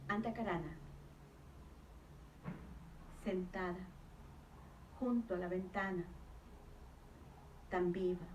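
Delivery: hushed-paced verse with long pauses between short phrases; spare, deliberate poetry reading; poem recitation. Real, not acted. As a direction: recite line by line, letting each image hang. Recites her own poem.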